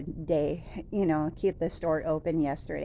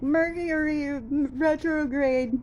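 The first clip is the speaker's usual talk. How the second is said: emotional outburst; 6 semitones higher than usual. Retold or imitated. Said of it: "I actually got a little emotional".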